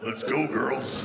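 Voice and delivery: low, slow country-star call; a drawn-out, low pop-country intro line. Acted. Comic imitating a singer's song intro, low and slow. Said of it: "That's my [name] impression".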